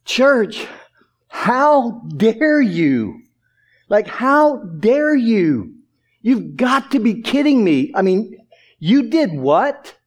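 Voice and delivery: slow, incredulous rebuke with drawn-out words; appalled, disbelieving scolding; utter disbelief. Acted. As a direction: draw it out as if you can't believe they dared. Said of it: "showing Paul's utter disbelief".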